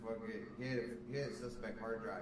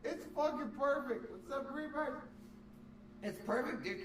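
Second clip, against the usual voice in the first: much higher, delighted; gleeful excitement; 12 semitones higher than usual. Real, not acted. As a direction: rave about how perfect it is. Gleeful about the joke.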